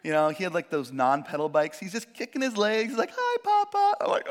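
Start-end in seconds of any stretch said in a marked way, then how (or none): 3.1-4.0 put-on high, light child's voice; little son. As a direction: chirp it like a small boy. Small son greeting father.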